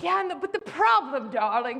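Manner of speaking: a hint of a grand society lady; lightly imitating a grand old hostess. Hostess explaining her party problem, lightly grand. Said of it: "'cause she literally talked like that".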